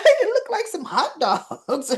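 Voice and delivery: laughing through the words; amused giggle. Real, not acted. Fan laughing at hot dogs on the plate, giggling. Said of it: "laughing at hot dogs".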